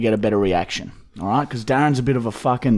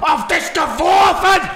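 mock German shout; 13 semitones higher than usual. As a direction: bellow it like an angry German. Shouting German phrases for laughs.

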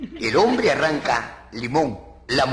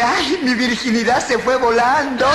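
high, loud comic cry; mock wail with a long held ending; mock scream; 8 semitones higher, 5 dB louder than usual. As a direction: cry out in hammed-up alarm. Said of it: "yo grité".

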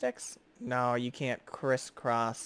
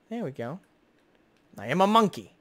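ordinary talk

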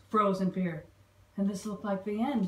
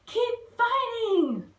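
high, loud, slow rallying call; cried; 13 semitones higher, 5 dB louder, much slower than usual. Acted. Dilly urging the bugs to keep fighting, loud cry. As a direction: drag it out to keep them going. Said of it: "cried, called Dilly".

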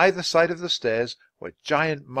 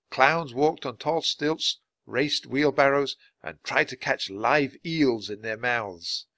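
usual speaking voice

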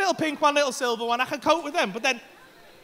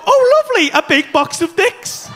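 high, loud sarcastic gushing; mock delight; 4 semitones higher, 5 dB louder than usual. Sarcastic imagined pleased reaction.

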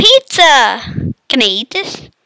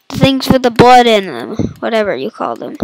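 ordinary talk, nothing marked

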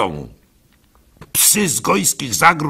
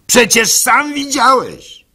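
rabbi's incredulous retort; 12 semitones higher, 7 dB louder than usual. Acted. Rabbi challenges Gimpel.

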